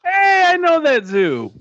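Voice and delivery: real burst of recognition, pitch way up; excited recognition. Real, not acted. Listener delighted at familiar zoo.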